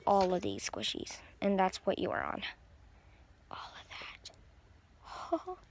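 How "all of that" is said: slow high whisper. Acted. Whispering to viewers over the whole pile. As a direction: draw it out in a hush.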